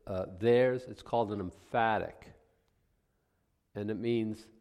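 normal talking voice

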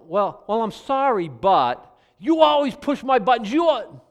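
high, loud, whining excuse; whiny blaming apology. Shown as a demonstration. Preacher mocking a blame-shifting apology, loud and whiny. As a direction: make it everyone else's fault, shrilly aggrieved. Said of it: "now it's your fault again".

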